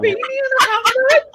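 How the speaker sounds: high, loud Brummie squeal through laughter; helpless laughter. Pretend. Woman cracking up at her own impression, laughing Brummie squeal. Repeats the Brummie line, breaking up.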